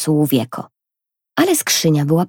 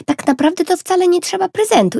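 put-on girl's voice; 13 semitones higher than usual. A narrator's reading. Girl's voice in story.

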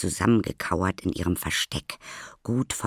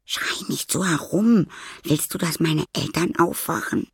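high, strained scolding; urgent scolding; 12 semitones higher, 4 dB louder than usual. A narrator's reading. Maya afraid her parents will wake, urgent scolding.

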